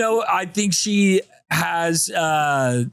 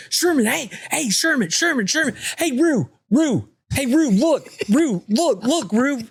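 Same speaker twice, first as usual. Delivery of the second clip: put-on high, eager little-boy calls; a kid tugging for a friend's attention, high-pitched; excited kid calling out; 10 semitones higher than usual. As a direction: pester her name over and over like a small boy.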